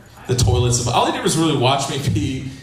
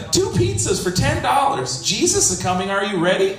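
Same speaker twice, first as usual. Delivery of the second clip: mock sign voice; 7 semitones higher than usual. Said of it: "it said".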